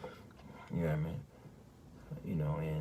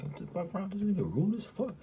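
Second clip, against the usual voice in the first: incredulous; 11 semitones higher than usual. Retold or imitated. Incredulous inner reaction to rude man.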